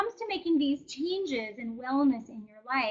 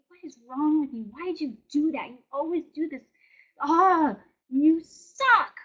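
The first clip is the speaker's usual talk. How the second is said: harsh inner critic; 3 semitones higher, 5 dB louder than usual. Retold or imitated. Mock scolding of yourself after a mistake, harsh inner critic. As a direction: berate yourself like a nagging critic. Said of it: "we would never talk to anybody else".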